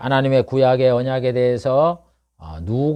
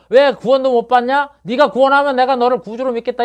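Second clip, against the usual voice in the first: higher, louder jeering taunt; mocking soldiers; 9 semitones higher, 5 dB louder than usual. Soldiers taunting Jesus, in a higher, louder jeering voice. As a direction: sneer at the man on the cross. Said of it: "조롱을 하고 그러잖아요".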